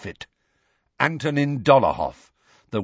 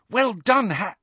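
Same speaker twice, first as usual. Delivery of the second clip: high congratulation, cut off mid-word; excited praise; 10 semitones higher than usual. A narrator's reading. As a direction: cheer a friend and break off abruptly.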